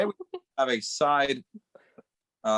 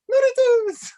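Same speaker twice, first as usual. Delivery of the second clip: squealed high, loud cheer; high cheerleader shout; 7 dB louder, slower than usual. Retold or imitated. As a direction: belt it like a cheerleader. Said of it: "And Eloy goes".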